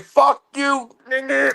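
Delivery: high, mimicked shout of an online troll; mock trash-talk shout. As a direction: imitate a raging chat player. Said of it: "people be talking shit like".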